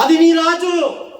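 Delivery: high, slow, loud proclamation; triumphant shout. Real, not acted. Preacher declaring the king to the congregation, triumphant shout. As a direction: cry it out long and loud. Preacher's climax after hallelujahs.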